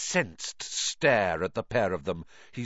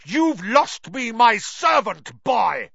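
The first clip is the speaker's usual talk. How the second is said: loud, raised, drawn-out rage; angry shout; furious villain; 9 semitones higher, 7 dB louder, slower than usual. A narrator's reading. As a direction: roar it at the boy, higher and slower. Villain lunges at Harry in rage.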